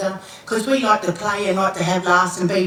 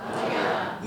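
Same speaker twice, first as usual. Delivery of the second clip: called out name; 8 dB quieter than usual. Shown as a demonstration. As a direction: call the name out clearly for all to echo. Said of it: "Everyone say".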